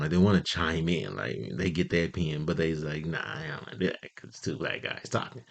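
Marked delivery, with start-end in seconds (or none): 3.1-5.4 hushed mock bystander aside; reluctant muttering. Onlookers at Denny's wanting to chime in, hushed and reluctant. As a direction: say it under your breath, holding back.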